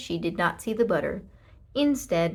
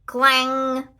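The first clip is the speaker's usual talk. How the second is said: clang; 5 semitones higher, 9 dB louder than usual. A narrator's reading. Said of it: "went the toaster".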